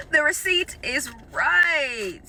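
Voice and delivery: slow drawn out. Real, not acted. Rechecking the grocery receipt, she drags it out slowly. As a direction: drag each word out slowly in disbelief.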